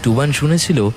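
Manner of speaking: ordinary delivery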